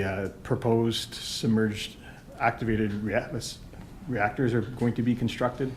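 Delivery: ordinary talk, nothing marked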